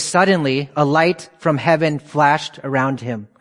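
slowed down, leaning on suddenly. Shown as a demonstration. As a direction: draw the verse out for weight. Verse reread slowly for emphasis.

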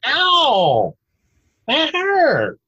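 high, exaggerated ow of pain; mock pained drunk. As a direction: ham up a drunk yelping in pain. Acting out drunk's pained reaction.

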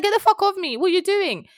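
high, loud indignant outburst; retold angry protest. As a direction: act out shoving him off, loudly. Acts out her angry refusal.

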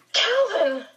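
high-pitched, urgent call; gasped cry. A narrator's reading. Meg calls to Calvin as Charles wrenches free, gasping. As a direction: cry out for help, pitch leaping up. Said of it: "she gasped".

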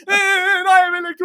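put-on high, loud, fast wailing child half laughing; boy begging grandmother, mock wailing and laughing; whiny crying boy. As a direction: whimper and plead like a scared little boy. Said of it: "jeg skreg og græd samtidig".

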